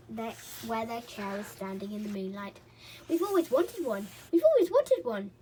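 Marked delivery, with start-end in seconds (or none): 3.1-5.3 high, loud greedy glee; gleeful gnome shouts. A narrator's reading. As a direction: chant with greedy delight. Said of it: "they shouted".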